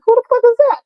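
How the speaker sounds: very high, incredulous squeal; squeaky mock outrage; retold yell. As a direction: reenact hollering at an unknown visitor, voice shooting way up. Retold yelling at the door.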